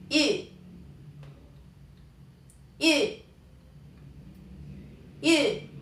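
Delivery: rounded Y vowel, high and falling; sound demo. Shown as a demonstration. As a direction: model Y as a long rounded ü. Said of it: "cómo se va a pronunciar". (sonido ü sostenido, agudo y descendente)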